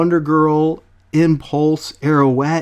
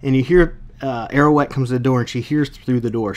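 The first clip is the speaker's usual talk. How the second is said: ordinary talk, nothing marked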